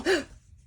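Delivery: sudden loud hiccup gasp; a sharp real hiccup. Real, not acted. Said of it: "Oh, sorry, hiccup".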